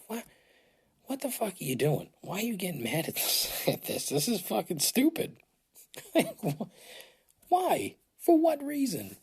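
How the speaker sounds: high-pitched, laughing disbelief at himself; incredulous self-scolding. Retold or imitated. Host mocking himself for getting mad over nothing, incredulous. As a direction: berate yourself in a high, exasperated voice. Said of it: "I thought to myself".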